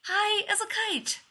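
slow, high-pitched grumble; muttering. A narrator's reading. Leonard judging the babbling stranger, exasperated mutter. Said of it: "he mutters".

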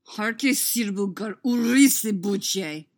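slow, loud, through clenched teeth; ground out. A narrator's reading. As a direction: grind the words out angrily. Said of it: "Cody grinds out, clenched teeth".

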